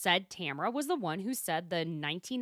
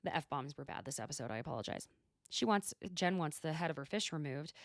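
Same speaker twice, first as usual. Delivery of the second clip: usual speaking voice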